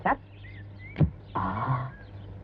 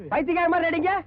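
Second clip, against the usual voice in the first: usual speaking voice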